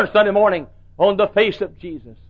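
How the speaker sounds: usual speaking voice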